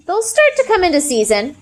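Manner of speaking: high, forced brightness; mildly forced cheerful tone; fake cheer. A narrator's reading. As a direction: paste on cheer to hide guilt. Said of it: "putting as much fake cheer".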